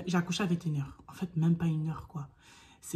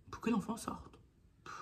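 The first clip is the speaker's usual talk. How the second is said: normal talking voice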